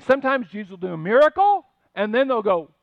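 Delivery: ordinary talk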